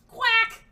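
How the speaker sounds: high, loud silly quack; loud duck quack. Pretend. Joking about the villain's alert, loud quack. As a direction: honk it like a space duck. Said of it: "Majestic space duck".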